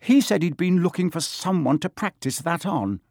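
high, shaken voice; shaky. A narrator's reading. Shaken boy tells friends of the attack, shakily. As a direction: pitch it up as if still trembling. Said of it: "said Neville shakily".

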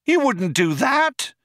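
very high cry of protest; Trillian crying out. A narrator's reading. Trillian protests the cops' threat, crying out. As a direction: exclaim it in disbelief. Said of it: "cried Trillian".

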